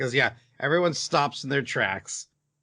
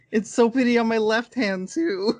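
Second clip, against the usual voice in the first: higher-pitched mock girlish delight, laugh creeping in; delighted little girl; 8 semitones higher, 4 dB louder than usual. Podcaster voicing the girl with the stolen ring, playfully delighted. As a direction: imitate a girl gushing over her treasure.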